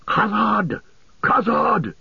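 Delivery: high, ringing war cry; fierce battle cry. A narrator's reading. Gimli leaping from the wall into battle, fierce battle cry. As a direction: cry it out far above speaking pitch. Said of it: "with a fierce cry that echoed".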